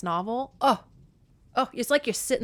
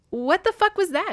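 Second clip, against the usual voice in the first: high, louder stunned question; shocked exclamation; 11 semitones higher, 5 dB louder than usual. Retold or imitated. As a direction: blurt it out in shock. Hades voiced in retelling.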